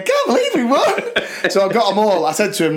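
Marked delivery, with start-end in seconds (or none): 0.0-1.0 put-on high happy girls' voices, laughing; giddy girls. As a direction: mimic the elated team. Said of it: "they were just going".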